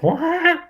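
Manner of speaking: a sudden very high squeaky "Wah!" like a little droid's fart noise; droid raspberry squeal. Retold or imitated. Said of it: "gives you a little, like, fart noise".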